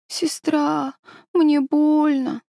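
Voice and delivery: high, slow delirious moan; feverish.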